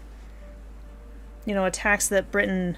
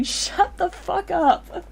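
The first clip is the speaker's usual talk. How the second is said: laughing; 9 semitones higher, much slower than usual. Real, not acted. Laughing retort.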